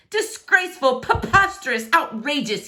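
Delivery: high, sharp string of insults; spitting outrage. Acted. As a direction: spit each word in fury. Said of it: "he spat".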